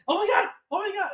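high panicked cry; frantic oh my God. Retold or imitated. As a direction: squeal it in panic as the mobs close in. Panicking in the dream game.

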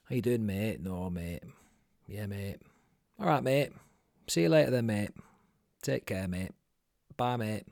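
mock Burnley accent on every mate; short Burnley greetings and goodbyes, playfully mimicked. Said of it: "another accent on this podcast".

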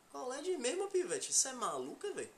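high, Bahian slang delivery; Bahian accent. Shown as a demonstration. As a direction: say it like a Salvador local. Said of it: "você fala assim".

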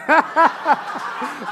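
high, loud burst of laughter. Real, not acted. Preacher amused by her answer, loud high laugh.

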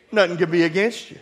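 ordinary talk

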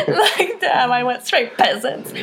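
words bubbling out through laughter; real laughing self-mockery. Real, not acted. Speaker amused at remembering only peasants, laughing. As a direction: tease yourself while cracking up. Laughing at her own slip.

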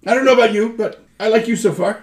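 high, loud friendly weasel voice. Acted. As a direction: warm up to them in a high creature voice. GM voices Gerhardt.

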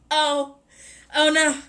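ordinary delivery

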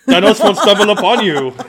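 loud, high mock Austrian accent; hammed-up Austrian accent breaking into laughter; Arnold Schwarzenegger. Shown as a demonstration. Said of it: "I can't do an Arnold accent very well".

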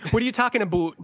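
put-on teasing Canadian accent. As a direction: exaggerate the Canadian "aboot". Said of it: "I love Canadian accents".

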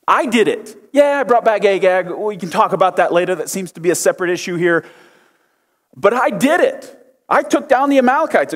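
high, breezy dodge; slick Saul. Acted. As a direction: brush it off slyly. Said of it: "Did you see what he did there?".

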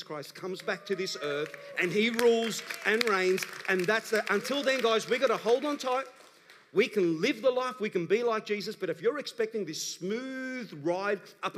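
full-force preaching. Acted. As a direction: drive it home to the congregation.

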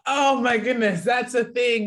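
loud, high exasperation; exasperated outburst. Real, not acted. Exasperated reaction to film stereotype.

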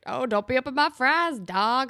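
hammed-up schoolyard teasing; mocking imitation. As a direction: taunt him like a jeering classmate. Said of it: "make fun of him".

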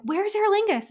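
high, loud, panicked asking; frantic question. Retold or imitated. Casting director asks a stranger while running through airport, frantic high question. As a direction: call it out desperately, pitch jumping up.

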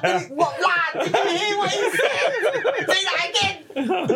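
very high, laughing disbelief; squealed, drawn-out mock astonishment; mock incredulity. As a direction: imitate friends cracking up in amazement. Acts out friends' amused disbelief.

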